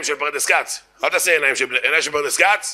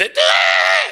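stretched-out, broken croak dropping low; sick-chick voice; 9 semitones lower, 4 dB louder, much slower than usual. Acted. Startled heckler losing his nerve, sick-chick voice. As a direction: bleat it like an ailing chick. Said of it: "קול של גוזל חולה עם בעיות רגשיות".

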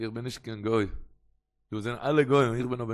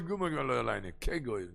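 normal talking voice

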